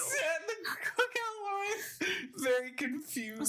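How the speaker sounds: high squealing laugh. Real, not acted. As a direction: laugh the words out slowly. Laughing recap.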